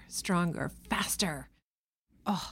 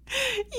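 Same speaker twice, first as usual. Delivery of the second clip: high giggle; 5 dB quieter than usual. Real, not acted. Giggling mid-spelling.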